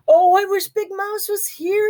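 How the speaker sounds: high, pleading wail; scared little mouse wishing for help; frightened cry. A narrator's reading. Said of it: "he cried".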